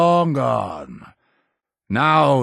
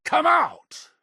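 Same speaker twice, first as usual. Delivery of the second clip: high, carrying demand; calling out. Acted. Ordering the hidden child to come out, high call. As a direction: call out to someone in hiding.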